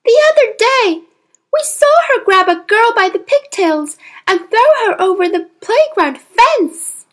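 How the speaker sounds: put-on a girl recounting something shocking; young girl voice. A narrator's reading. Matilda voiced as child.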